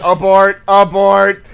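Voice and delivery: loud repeated warning bark; urgent play-acted shout; mock alarm. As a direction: call it out like an emergency order. Mock warning to stop the dirty bit.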